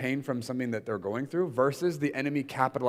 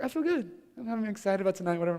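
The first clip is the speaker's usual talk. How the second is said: higher, breezy mock-cheerful intern voice; imitating the intern; 9 semitones higher, faster than usual. Speaker mimics intern claiming she feels fine, higher voice. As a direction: play the intern brushing it off with forced cheer. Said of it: "She's like".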